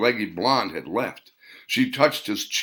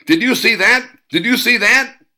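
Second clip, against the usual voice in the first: loud, high shriek; a blonde shrieking into the pickup window; screaming; 14 semitones higher, 9 dB louder than usual. A narrator's reading. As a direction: scream it in disbelief.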